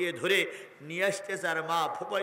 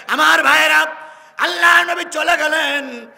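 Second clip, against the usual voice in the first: preaching cry; 11 semitones higher, 16 dB louder than usual. Acted. Preacher calls out to crowd.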